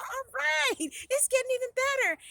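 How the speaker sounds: high-pitched happy anticipation; delighted. A narrator's reading. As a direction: think it gleefully, better and better. Heroine hears of more good news.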